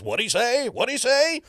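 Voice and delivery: high, garbled duck squawk; Donald Duck voice. Shown as a demonstration. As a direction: classic mumbled cartoon duck. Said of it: "the shtick is".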